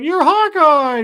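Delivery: high, loud, slow starstruck exclamation; starstruck gasp. Retold or imitated. Kate, meeting her idol in the alley, cries out excitedly. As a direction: gush his name like a fangirl. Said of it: "And she's like".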